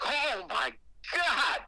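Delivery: panicked cry. Retold or imitated. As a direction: gasp it out as if jolted awake by pain. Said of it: "I was like".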